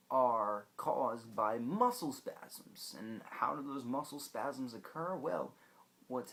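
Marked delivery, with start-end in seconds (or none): 0.1-2.9 slow emphasis. Real, not acted. Emphatic explanation.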